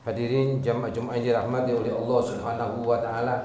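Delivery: normal talking voice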